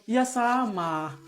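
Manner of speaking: soft sad reply. Acted. Father and son sad about country.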